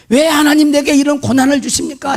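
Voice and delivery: retold high-pitched protest; insistent tantrum prayer. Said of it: "때를 쓰면서 기도할 것이라는". (떼쓰듯 높게 따지는 기도 재연)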